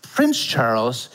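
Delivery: catching up, half amused; dawning realization. Retold or imitated. Mocking own brain realizing Charles is already king. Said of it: "this is literally my brain".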